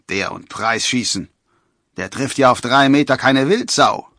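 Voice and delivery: loud, scornful jeer; mocking. A narrator's reading. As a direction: scoff at the youngster's chances. Drunk forester mocks the young archer.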